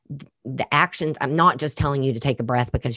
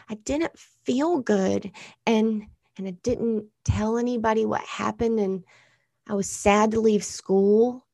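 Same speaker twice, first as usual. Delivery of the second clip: put-on sad little girl; 7 semitones higher than usual. Daughter voiced, sad after panic.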